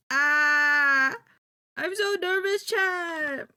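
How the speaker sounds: loud, high nervous yell to chat; nervous outburst. Real, not acted. Streamer yells to chat about her nerves, loud and high. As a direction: let the nerves burst out in a shout. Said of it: "I'm so fucking nervous".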